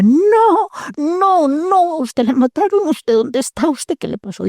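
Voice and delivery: frantic high shout, fading at the end; retold yelling. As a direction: cry out in shock as if hearing the dead are alive. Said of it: "ese muchacho gritaba".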